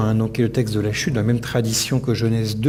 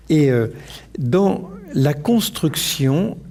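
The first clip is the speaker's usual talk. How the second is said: much slower, drawn-out words; slow right down, spacing out the phrase; deliberately slower; 2 semitones higher, much slower than usual. Real, not acted. Lecturer reminded to speak more slowly, deliberately slowed down. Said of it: "pardon, toujours plus lentement".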